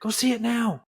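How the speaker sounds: high, loud, eager push; excited urging. Real, not acted. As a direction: urge them on with real excitement. Urging listeners to see it.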